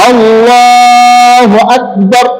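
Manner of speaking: আল্লাহু আকবার chanted very slowly, vowels stretched long; drawn-out recitation. Shown as a demonstration. Teacher showing students how to say the takbir, slow drawn-out chant. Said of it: "এই এইভাবে সুন্দর করে বলে".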